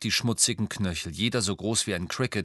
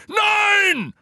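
very high, loud cry of warning; alarmed shout; 9 dB louder than usual. A narrator's reading. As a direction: scream to stop him grabbing. Hagrid warns as giant grabs. (gellender Warnschrei)

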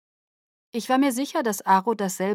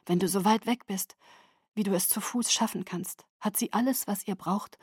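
soft urgent whisper in the ear; 5 dB quieter than usual. A narrator's reading. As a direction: breathe the plan into his ear. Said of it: "flüsterte ihm ins Ohr".

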